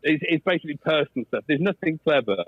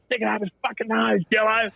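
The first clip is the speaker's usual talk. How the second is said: Aussie sledge; 7 semitones higher than usual. Retold or imitated.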